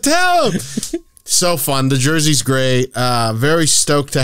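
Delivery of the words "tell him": high-pitched mock whoop; retold egging-on cheer. Host joins fans urging woman on, mock cheering. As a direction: urge her on in a high yell.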